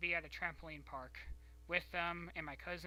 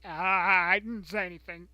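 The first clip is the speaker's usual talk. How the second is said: a much louder defensive cry; loud denial; 2 semitones higher, 16 dB louder than usual. Retold or imitated. Denying to grandma that she said anything, loud and defensive. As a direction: shout the denial. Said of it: "And I'm like".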